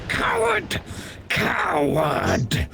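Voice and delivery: high screech of metal on stone, muffled; muffled screeching sword. Acted. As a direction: screech it from inside a scabbard. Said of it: "You just hear it screeching".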